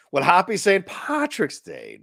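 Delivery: a slow, high mock Irish accent; hint of an Irish brogue. Shown as a demonstration. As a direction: wish him happy Saint Patrick's Day in a hammed-up Irish voice. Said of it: "the best I could do in that accent".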